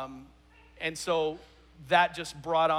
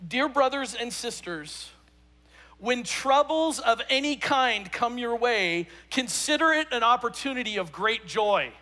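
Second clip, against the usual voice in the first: slow, louder Bible reading, every phrase weighed; scripture reading; 4 semitones higher, 4 dB louder than usual. Acted. Pastor reading James to his congregation, slow and solemn. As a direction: read the verse out slowly to the room.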